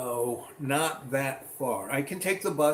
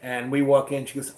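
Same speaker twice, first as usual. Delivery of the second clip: normal talking voice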